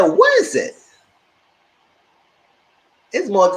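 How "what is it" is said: loud, high-pitched outburst of disbelief; exclaimed question. Retold or imitated. Retelling her outburst at the show.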